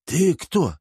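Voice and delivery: put-on slurred drunk question; drunk slurring. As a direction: slur like someone drunk. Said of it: "заплетающимся языком спросил".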